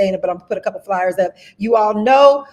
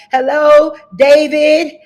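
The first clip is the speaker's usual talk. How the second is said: higher and louder call-out; excited hello; 5 semitones higher, 8 dB louder, slower than usual. Real, not acted. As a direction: greet him happily across the room. Greeting viewers in the live chat.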